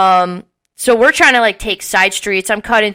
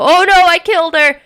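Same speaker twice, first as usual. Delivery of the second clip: high, louder cry of alarm; mock panicked cry; 9 semitones higher, 5 dB louder than usual. Cousin Malcolm thinks he killed her, mock panicked cry. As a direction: imitate a cousin wailing in panic.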